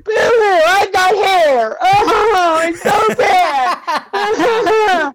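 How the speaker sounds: high, loud fake sobbing whine; mocking boo hoo. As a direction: wail like a mocked crybaby. Mocking friend's haircut with fake crying.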